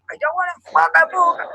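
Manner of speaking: high, squeaky mock of her own refusal; mock whiny refusal. Telling friend how she snubbed Paddy's burger, high mock whine. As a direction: send up her own huffy no in a piping voice.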